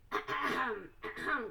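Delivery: polite throat-clearing ahem; fake cough. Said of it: "coughed Mister Lowe".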